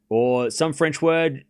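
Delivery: ordinary delivery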